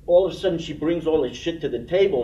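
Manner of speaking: ordinary delivery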